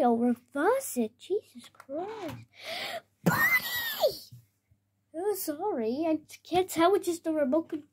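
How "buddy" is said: very high, sharp alarmed cry; shrill warning call. Acted. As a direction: yelp as the car nears the wall. Car heading for the wall.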